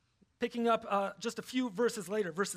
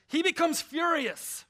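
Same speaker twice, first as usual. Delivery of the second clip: much higher, louder and slower; furious; 8 semitones higher, 5 dB louder than usual. Acted. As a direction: let the fury burst out. Preacher voices Herod's rage.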